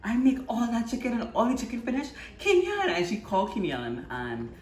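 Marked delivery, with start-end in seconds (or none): 0.0-2.9 mock outraged mother shouting a name; hammed-up angry mom call. Mother yelling for brother Kenyan.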